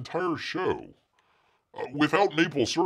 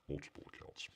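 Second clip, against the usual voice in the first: breathy whispered repeat of multiple accounts; whispered aside; 22 dB quieter, faster than usual. Real, not acted. Host whispering a sly aside to chat. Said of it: "In multiple accounts".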